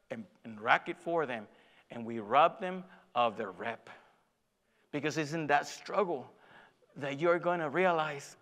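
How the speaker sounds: ordinary talk, nothing marked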